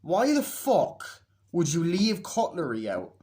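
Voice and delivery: loud, high, drawn-out disbelief; incredulous rant at full voice; exasperated. Real, not acted. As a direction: demand an answer, hitting each word slowly. Ranting about a dumb plot point.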